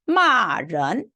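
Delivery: 마 falling from high, 른 rising; fourth then second tone. Shown as a demonstration. Teacher reading a word for students, falling 마, rising 른. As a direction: drop 마 hard, then lift 른. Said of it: "뒤에 거는 요 이성이니까".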